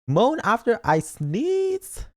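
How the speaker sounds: high, slow disbelief; slow squeaky outrage; incredulous. Real, not acted. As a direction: repeat it back, stunned and slow. Reacting to chat.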